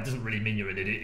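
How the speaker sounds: usual speaking voice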